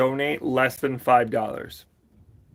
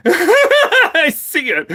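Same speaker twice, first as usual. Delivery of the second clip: high, loud laughing through the words; real laugh, pitched way up; 7 dB louder, much slower than usual. Real, not acted. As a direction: crack up while saying it. Laughing at being called Gru.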